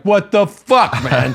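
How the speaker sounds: high, loud mock wail; outraged kid. Son told six hours remain, outraged. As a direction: cry out in disbelief, imitating a fed-up child.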